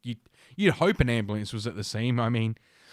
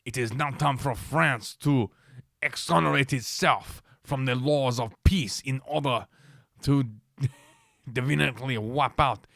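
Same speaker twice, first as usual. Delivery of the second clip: mock French accent for a quote. Reading the mayor's statement in a hammed-up French accent. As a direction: put on a stagey French accent. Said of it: "I'll get my French accent going".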